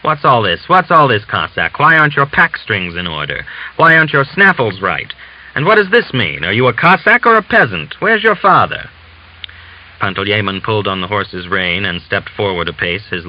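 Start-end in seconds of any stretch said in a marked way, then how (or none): 0.0-8.9 building scolding, higher and louder; raised voice. Acted. Commissary scolding the Cossack over his kit, voice rising and louder. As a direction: berate him like an angry officer. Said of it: "raised his voice".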